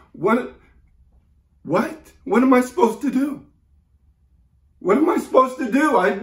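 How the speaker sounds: high, loud, rushed desperation; panicked pleading. Acted. Orphaned caller begging for direction, panicked pleading. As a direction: plead frantically for an answer.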